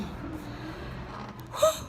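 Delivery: a high, breathless gasp of surprise; sharp gasp. Real, not acted. Startled on the ladder while painting, sharp gasp. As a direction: catch your breath in a sudden gasp.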